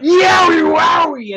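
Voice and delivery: high, loud drawn-out holler; goofy yell. Retold or imitated. Front-row fan calling out to Bray Wyatt, goofy yell. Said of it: "my goofy ass yells out".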